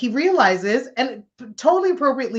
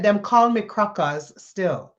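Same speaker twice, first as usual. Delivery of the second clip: slow, lightly imitated Caribbean accent; a hint of a Caribbean man's accent, slow and deliberate; 3 dB louder, slower than usual. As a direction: repeat it slowly in his Caribbean accent. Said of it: "in his Caribbean accent".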